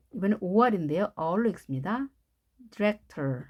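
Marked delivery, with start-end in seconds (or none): none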